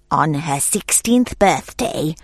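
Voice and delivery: a hint of a hissing wicked fairy; lightly voiced evil fairy, slow. A narrator's reading. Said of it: "she hissed".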